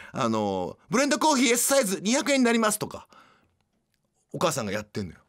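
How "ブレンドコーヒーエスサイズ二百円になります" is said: high, quick register patter; shrill service voice. Acted. Mother at café register states price, high and shrill. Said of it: "すごい甲高い声で".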